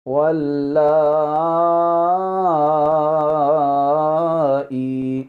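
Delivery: واللائی with the madd drawn out very long on لا; correct recitation. Shown as a demonstration. Teacher giving the correct reading, واللائی long and chanted. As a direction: stretch لا over several counts, then ائی. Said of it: "کیسے پڑھیں گے جی؟".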